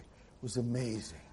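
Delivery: soft, slow, breathless amazed tone; girl sighing over the museum, softly; dreamy hushed gush. Retold or imitated. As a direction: gush it like a dazzled teen girl. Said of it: "When girls say that, like".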